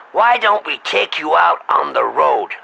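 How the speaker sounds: normal talking voice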